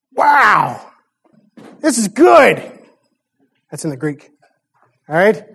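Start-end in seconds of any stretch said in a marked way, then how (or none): 0.1-2.6 higher, delighted wine taster; impressed master of the feast. Retold or imitated. Master of the feast tasting wine, delighted voice. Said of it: "And what does he say?".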